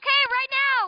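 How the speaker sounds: high, loud countdown call; excited alert. Real, not acted. Kid told the cannon will explode, excited alert. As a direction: announce it urgently.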